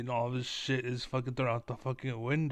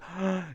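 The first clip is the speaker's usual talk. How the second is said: a high, theatrical gasp of outrage; exaggerated pearl-clutching gasp; mock shocked gasp; 5 semitones higher than usual. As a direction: gasp like a scandalized fan. Imitating shocked audience gasp.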